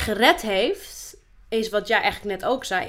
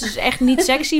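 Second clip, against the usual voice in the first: usual speaking voice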